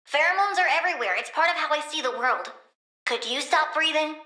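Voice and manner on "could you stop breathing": usual speaking voice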